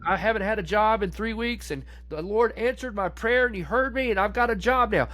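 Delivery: high, sing-song parody of a believer; high mock testimony voice; mocking smug churchgoer. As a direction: lampoon a trivial testimony, high.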